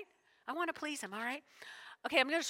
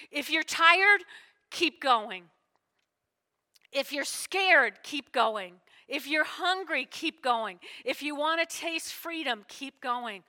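preaching loud; 3 semitones higher, 6 dB louder, slower than usual. Acted. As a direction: hammer each keep going home. Preaching Tubman quote to congregation.

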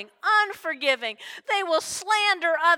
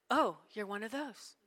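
mock judgmental gossip; 3 semitones lower, 7 dB quieter, faster than usual. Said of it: "And Jesus wasn't like".

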